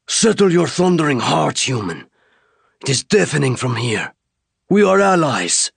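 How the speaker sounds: high alien voice, firm and calming; alien warrior reassuring. Acted. Alien warrior to the shaking human: firm, reassuring alien voice. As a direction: settle the human down.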